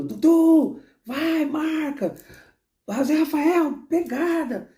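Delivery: high-pitched mock coach yells from the touchline; shouting coach. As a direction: yell orders at players in a strained high voice. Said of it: "Você não vê o Abel gritando".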